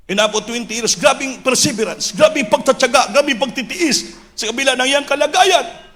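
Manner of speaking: high, impassioned preacher's proclamation; full-force preaching. Real, not acted. Preacher extolling the pastors' perseverance to the church, full-force preaching. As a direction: build the list of sacrifices to a shout.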